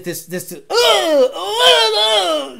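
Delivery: high, strained mock stammer; exaggerated selling. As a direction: parody the over-the-top hype. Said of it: "this exaggerated selling".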